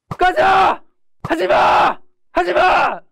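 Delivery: sudden high, full-force yell; bursting shout. Acted. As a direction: let pent-up feeling burst out at the bully. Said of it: "소리를 좀 지르고".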